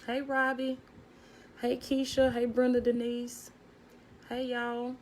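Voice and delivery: high, lively hellos to each viewer; bright high greetings. Real, not acted. Greeting viewers joining the live, high and lively.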